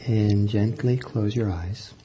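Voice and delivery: slow, soft meditation voice with long pauses; guided meditation. Acted. Teacher guiding students into breathing, soft and slow. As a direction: ease them into the breath, unhurried and gentle.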